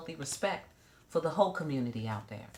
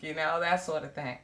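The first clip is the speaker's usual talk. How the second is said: ordinary delivery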